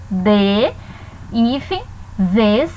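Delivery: "De", "hífen", "zes" each said alone with pauses; separated syllables. Shown as a demonstration. Teacher showing the prefix is split, piece by piece. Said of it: "Vai ser separado".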